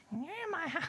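high squeaky bird claiming its home; a tiny bird squeaking at the intruder; mock bird voice. The bird squeaks high at the squatter. Bird speaks about her nest.

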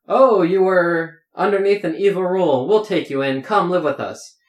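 lightly hammed-up welcoming elf. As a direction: welcome them in like a kindly stage elf. Elves welcome refugees in play.

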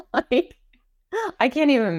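high-pitched laugh breaking into the word; real high laughter mid-sentence; incredulous laughing. Real, not acted. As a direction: laugh at how absurd it is, voice jumping up high. Laughs at absurd threats received.

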